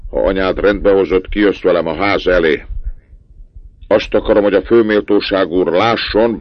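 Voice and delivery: father ordering his son out before the count passes; stern commands. A narrator's reading. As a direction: hurry the boy out, stern.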